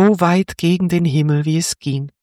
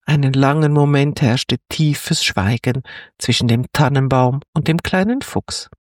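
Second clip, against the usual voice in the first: usual speaking voice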